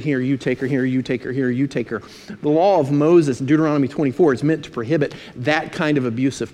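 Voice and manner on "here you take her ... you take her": low, monotone repetitive patter; mock back-and-forth swapping in a lowered voice; offhand trading. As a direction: rattle it off like a casual handoff. Acting out men trading wives.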